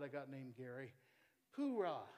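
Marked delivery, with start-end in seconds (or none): 1.6-2.1 Purah said louder, POO-rah, clipped fall; pronouncing Purah. Shown as a demonstration. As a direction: hit Purah clearly. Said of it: "That's how you pronounce it".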